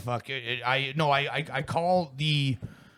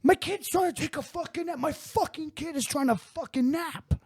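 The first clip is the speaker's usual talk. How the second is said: much higher, strained mock yell of a crazy neighbor; mock screaming neighbor. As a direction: imitate the psycho neighbor losing it. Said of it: "he starts screaming it then".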